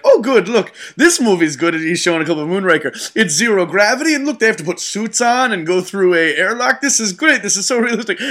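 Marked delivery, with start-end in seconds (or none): 0.0-1.7 lightly voiced pleased little movie critic; a touch higher, mildly approving; claymation Albert Einstein. Retelling a claymation film at the Smithsonian, mildly delighted. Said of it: "claymation Albert Einstein being like". 3.2-8.2 higher, delighted mock approval; claymation Albert Einstein. Claymation physicist watching a space movie clip, delighted. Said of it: "claymation Albert Einstein being like".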